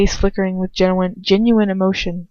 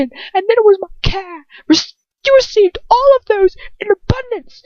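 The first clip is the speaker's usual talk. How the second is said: high, raised angry voice; angry outburst; 14 semitones higher than usual. Acted. Lucius lashing out at her, high and angry. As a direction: pour out the grievance.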